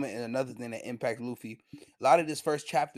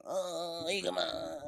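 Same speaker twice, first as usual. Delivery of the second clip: higher, drawn-out anime-style cry; 5 semitones higher, 3 dB quieter, much slower than usual. Retold or imitated. Mocking anime dialogue for Higuma, high drawn-out cry. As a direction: call the name like an anime character.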